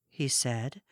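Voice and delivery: plain reading voice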